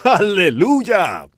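a loud, drawn-out shout of praise; retold joyful shout. Paul on the ship shouting to the crew, loud joyful cry. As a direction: stretch it out like a cry of joy on deck. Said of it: "y agitar su pequeña mano y gritar".

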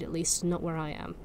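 ordinary delivery, nothing marked

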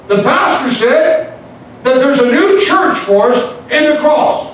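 high, loud, climbing to a sustained shout; rally the church at the top of your voice; fervent revival rallying cry; full-force preaching. Real, not acted.

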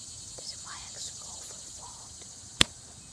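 slow whispered aside. Real, not acted. Secret aside to kids.

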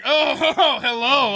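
high, slow mock-surprised hello; hammed-up greeting. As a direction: draw out a high, showy hello through a laugh. Re-enacting his video opening.

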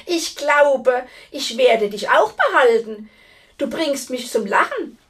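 loud, gloating giantess; scornful amusement. A narrator's reading. Giantess mocking her would-be rival, loud gloating amusement. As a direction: boast loudly, amused by the challenger. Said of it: "lachte die Riesin".

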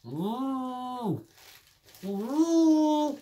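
high muffled sounds through stuffed cheeks; cheeks stuffed full. Real, not acted. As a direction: squeal past a crammed mouth. Ten marshmallows in mouth.

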